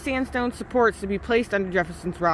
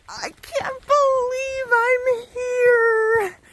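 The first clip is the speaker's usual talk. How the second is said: awestruck; 8 semitones higher, slower than usual. Real, not acted. Arriving at Harper's Ferry, awestruck.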